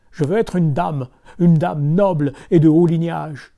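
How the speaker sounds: loud furious wife; angry shout. Acted. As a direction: rage at the stupid husband. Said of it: "tout en colère".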